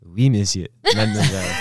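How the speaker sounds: loud, theatrical French greeting; French accent. Acted. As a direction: announce it proudly in French. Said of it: "He actually knows French".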